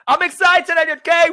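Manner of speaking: high, loud excited shout; best excited. Shown as a demonstration. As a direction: explode with excitement on request. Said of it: "Give me your best excited".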